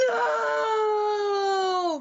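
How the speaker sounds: high, far-flung cry of despair; big dramatic no; dramatic wail. Shown as a demonstration. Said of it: "Big dramatic".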